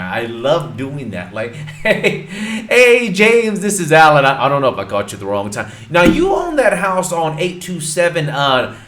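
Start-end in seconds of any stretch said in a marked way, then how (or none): 1.8-8.8 bright, higher and louder friendly greeting; cheery cold call. Shown as a demonstration. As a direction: call James like an old buddy. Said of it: "Throw in some laughs in there".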